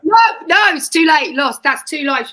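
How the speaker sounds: high, loud gleeful no; excited gloating. Real, not acted. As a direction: crow over the win. Game, gloating that he lost.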